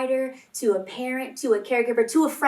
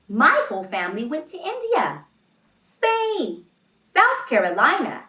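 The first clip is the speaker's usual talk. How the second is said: put-on excited kid; 4 semitones higher, 7 dB louder than usual. Child boasting about travels.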